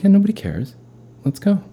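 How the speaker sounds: high, easygoing coach voice. Retold or imitated. Brother as coach hurrying her along. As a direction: shrug it off like a coach. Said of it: "the Little League baseball coach".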